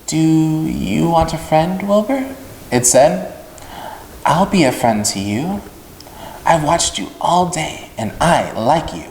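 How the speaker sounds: a tiny voice out of the dark; thin but pleasant. Acted. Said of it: "It sounded rather thin, but pleasant".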